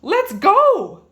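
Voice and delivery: high, loud crow; Cocky Locky crowing eagerly; crowing rooster. A narrator's reading. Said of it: "crowed Cocky Locky".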